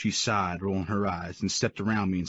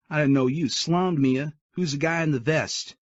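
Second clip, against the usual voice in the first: put-on higher, louder cocky young guy's voice; snide teen boy; 8 semitones higher, 5 dB louder than usual. A narrator's reading. Young date taunting the intruder, snide and higher. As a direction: needle him like a smug kid. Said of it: "Little shit behind me asked".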